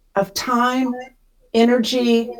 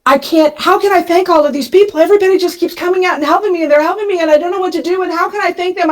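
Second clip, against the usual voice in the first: higher, fast, breathless panicked babble; frantic overwhelmed rush; 7 semitones higher, faster than usual. Retold or imitated. Single mom in a truck panicking over how to thank helpers, frantic rush. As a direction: spill it all out in a flustered rush, voice pitched up. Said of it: "And I am losing it".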